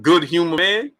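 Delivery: lightly put-on dismissive voice; lightly acted comeback, rising at the end; imitated sitcom character. Streamer acting out the Martin scene, lightly put-on voice. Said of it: "He's like".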